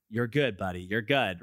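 low, soothing dad voice; calming reassurance. Retold or imitated. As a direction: settle an upset child, low and steady. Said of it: "just calm down".

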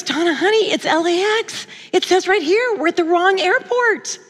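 high-pitched imitation of an exasperated husband; insistent husband. Husband shows wife the tickets, retold high insistence. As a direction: press the bad news home, high-pitched.

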